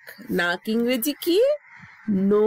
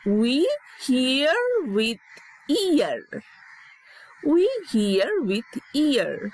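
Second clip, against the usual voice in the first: slow, word by word, We hear with ear; slow model sentence; 2 semitones higher, much slower than usual. Shown as a demonstration. Teacher modeling a sentence for kids, slowly. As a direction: spell each word out slowly. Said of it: "teacher models sentence slowly".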